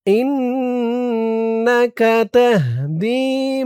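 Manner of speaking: drawn-out, melodic dua chanting; slow Quranic-style recitation of a prayer; slow Arabic recitation. Shown as a demonstration. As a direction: recite each syllable long and lilting. Recites the phrase.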